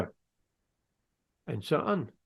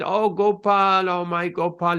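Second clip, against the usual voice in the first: higher, louder, slow yearning call; longing cry; 4 semitones higher, 6 dB louder than usual. Retold or imitated. Cowherd boy recognizing his Gopal in Narayan, slow longing cry. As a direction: cry out to the beloved in wonder.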